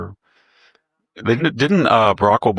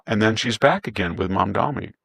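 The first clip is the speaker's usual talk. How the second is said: ordinary talk, nothing marked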